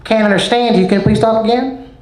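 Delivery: lightly voiced clerk who can't follow him; impatient cashier.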